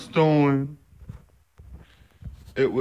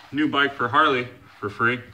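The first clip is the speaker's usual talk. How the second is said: normal talking voice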